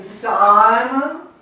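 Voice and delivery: SIMON with a long, loud first syllable; stressed first syllable. Shown as a demonstration. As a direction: stretch and punch the SI in Simon. Demonstrating metrical stress.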